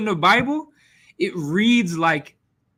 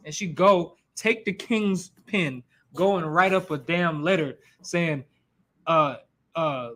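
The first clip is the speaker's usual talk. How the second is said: ordinary talk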